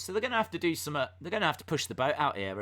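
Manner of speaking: usual speaking voice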